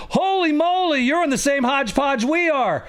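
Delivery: high-pitched burst of amazement; astonished exclamation. A narrator's reading. Principal realizing the nurse has it too, high and amazed. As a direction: exclaim it high, as a sudden discovery.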